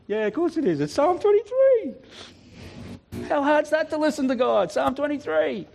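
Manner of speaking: high, sarcastic of course; mock exasperated. Preacher mocking his own doubt, high sarcastic exasperation. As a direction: roll your eyes at how obvious it was.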